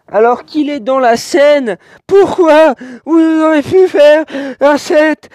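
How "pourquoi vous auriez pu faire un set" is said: exasperated. Real, not acted. Complaining to toy makers.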